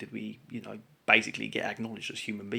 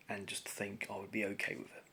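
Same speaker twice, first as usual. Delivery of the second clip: whisper; 2 semitones lower, 10 dB quieter than usual. Real, not acted. Quiet aside while ranting.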